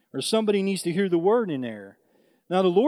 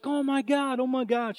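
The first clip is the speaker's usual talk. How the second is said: mock scream; 8 semitones higher than usual. Clerk finds a woman upside down, high mock scream. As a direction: imitate a screaming clerk without shouting. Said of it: "the clerk starts screaming".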